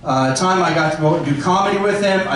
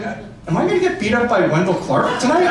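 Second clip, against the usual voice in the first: laughing worry; 4 semitones higher than usual. Retold or imitated. Retelling fear of getting beaten up.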